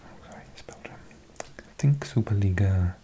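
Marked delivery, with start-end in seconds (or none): none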